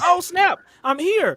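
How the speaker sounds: thrilled to have landed; excited arrival. Retold or imitated.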